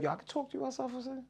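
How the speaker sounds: high, fast, hushed; hushed aside. Retold or imitated. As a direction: pull him aside quietly, quickly. Tattoo artist quoted, hushed.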